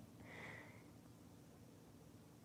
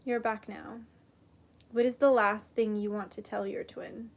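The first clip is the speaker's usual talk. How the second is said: soft, hushed meditation voice; guided meditation; 11 dB quieter than usual. Acted. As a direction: guide them gently into calm. Leads a meditation and prayer.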